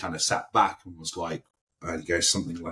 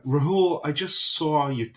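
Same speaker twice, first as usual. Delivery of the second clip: high, loud hammed-up American accent, slow on saw you; a deliberately bad American accent, calling out across the set; bad American accent, higher and louder, mimicking an actor; terrible American accent; 9 semitones higher, 5 dB louder, slower than usual. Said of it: "terrible American accent I'm doing".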